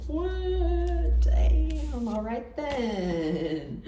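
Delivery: a startled, high "What? Damn" that slows into a drawn-out, impressed "all right then"; mock surprise. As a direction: play someone caught off guard who comes round to being impressed. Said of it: "Just these two alone are like".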